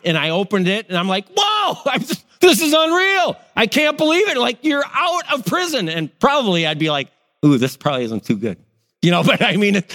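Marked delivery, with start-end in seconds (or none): 1.3-5.9 much higher, laughing disbelief; mock astonishment. Friend from prison at door. 7.4-8.6 lower, fast, uneasy aside; a quick worried doubt; wary second thought. Retold or imitated. Second thought about the visitor.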